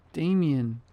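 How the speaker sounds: understated yell of a name in fright; startled yell. A narrator's reading. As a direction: call out as if startled, held back a little. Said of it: "I yelled".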